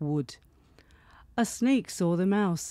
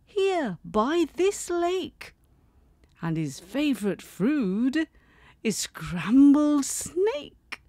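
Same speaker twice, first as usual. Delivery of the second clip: high mouse voice; 6 semitones higher than usual. Acted.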